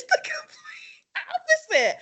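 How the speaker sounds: whispered words dissolving into a laugh. Real, not acted. Admitting to her friend she's soft, laughing. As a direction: laugh it out breathily under the words.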